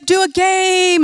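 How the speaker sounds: high, excited imitation of a camp leader; hyped-up game announcer. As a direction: announce it big to a room of kids. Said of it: "a leader comes up and they said".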